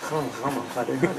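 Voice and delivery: the mantra with a throaty French r in "Rama", soft; French accent. Retold or imitated. Imitating French devotees, French-accented chanting. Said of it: "if you're from France".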